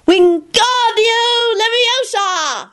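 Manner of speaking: high, very slow spell cry; boy levitating Kenny; shouted spell. A narrator's reading. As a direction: cast it with all his might. Teddy casting excitedly.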